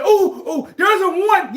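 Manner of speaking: high, loud mock outburst; excited objector. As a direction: burst in like someone eagerly objecting. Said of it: "Now, you might say".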